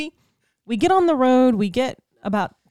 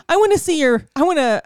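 shrill-pitched higher, louder mock Karen whine; mock entitled Karen; 7 semitones higher, 6 dB louder, faster than usual. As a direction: play the entitled driver demanding her rights, hammed up. Mocking an entitled driver's demands.

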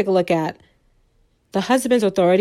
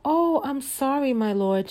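higher, meek put-on wife voice; apologetic wife; 5 semitones higher, 3 dB quieter than usual. Wife apologizing to her husband for the food, meek and high. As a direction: apologize submissively to your master.

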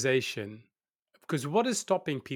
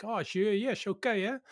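higher, mock-breezy "sure, okay"; sarcastic brush-off; 8 semitones higher than usual. Host mocking his inner critic, higher and airily dismissive. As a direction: brush the voice off like it's nothing. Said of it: "I'm like".

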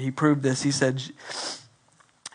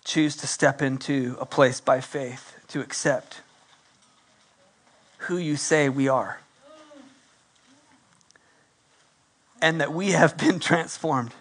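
closing prayer; 2 semitones lower than usual. Acted.

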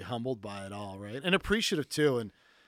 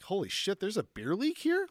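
high, rising amazed question; mock disbelief; 8 semitones higher than usual. Telling his friend how the team reacted, in high mock amazement. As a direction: play it as stunned delight that this even exists. Amazed at Mexican beer league.

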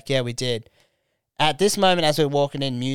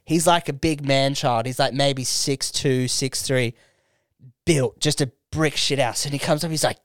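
usual speaking voice

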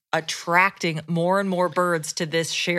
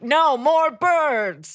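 slow, loud, higher mock maniac; maniac neighbor; 6 semitones higher, 5 dB louder, much slower than usual. Advising friend voicing a maniac neighbor, slow loud mock shout. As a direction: drag it out like a crazed neighbor.

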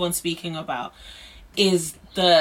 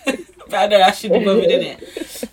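laughing; 3 semitones higher, 7 dB louder than usual. Real, not acted. Laughing at the friend's tease.

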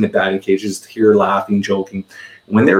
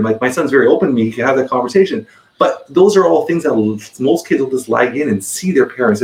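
normal talking voice

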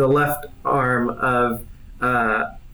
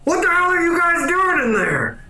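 put-on high, indignant old man shout; 14 semitones higher than usual. Old scientist bursting in on the intruders, high indignant cry. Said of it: "an old man go".

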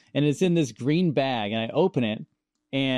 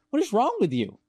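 higher-pitched imitation of a concerned wife; mildly mock-alarmed, pitched up; retold bewildered question; 10 semitones higher than usual. Wife asks crying husband what is wrong, mock bewilderment.

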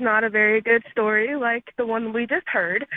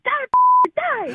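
put-on much higher, louder squeaky toddler battle cry; 13 semitones higher, 6 dB louder than usual. Two-year-old copying mom killing the bug, squeaky shriek. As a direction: shriek like a tiny warrior. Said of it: "comes behind me".

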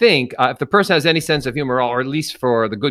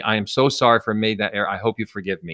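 quick apology; 4 semitones lower, 5 dB quieter, faster than usual. Shown as a demonstration. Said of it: "a really quick apology".

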